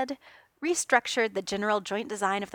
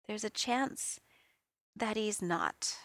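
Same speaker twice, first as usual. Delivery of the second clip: softer, slow, gently breaking hard news; kind and gentle; 8 dB quieter, slower than usual. A narrator's reading. Reed gently telling Tony his teammate may be dead, soft and kind. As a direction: ease the blow, soft and kind. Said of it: "his voice was unexpectedly kind, gentle almost".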